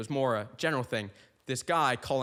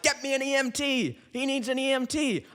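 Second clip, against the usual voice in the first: urgent call for help; 10 semitones higher than usual. Pretend. Mate calls for help, heart attack.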